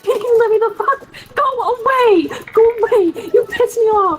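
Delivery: high-pitched, worked-up rant; exasperated outburst. Real, not acted. Gamer raging at teammates during play.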